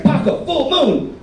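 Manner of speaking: high, slow mock-excited wake-up; imitated excitement. Girlfriend waking partner at midnight, imitated excitement. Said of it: "She was so excited".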